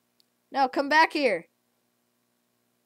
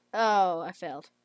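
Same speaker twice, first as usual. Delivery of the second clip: normal talking voice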